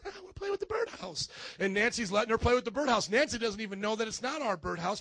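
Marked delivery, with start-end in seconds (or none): 0.0-1.3 put-on high, soft little toddler voice; two-year-old girl. Pastor voices his toddler spotting a birdhouse, little-girl voice. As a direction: pipe up like a tiny girl. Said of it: "She's a little two-year-old girl".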